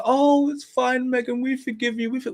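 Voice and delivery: very high, sing-song mock forgiveness; mock forgiving public. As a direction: imitate a crowd gushing forgiveness. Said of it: "and they would all go".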